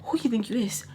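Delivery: hissed under the breath; whispered challenge. Retold or imitated. Whispering the challenge to the woman in her face. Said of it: "'cause I'm like".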